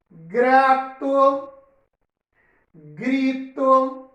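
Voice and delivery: "Grato, grito, brinco, trator, prato" one at a time with tapped R; word-list delivery, light R in "Grato, grito, brinco, trator, prato"; soft R examples. Shown as a demonstration. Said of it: "Agora vamos falar de R brando". ("Grato, grito, brinco, trator, prato" com R brando, palavra por palavra)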